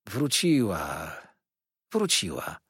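slow, low, soothing repeat; calm the frightened boy gently; Peggotty soothing the boy, slow and low; reassuring. Acted. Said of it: "uspokajała mnie Peggotty".

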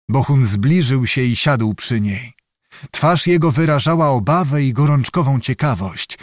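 ordinary delivery

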